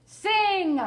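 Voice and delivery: bellowed. A narrator's reading. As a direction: bellow the order. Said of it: "bellowed the giant".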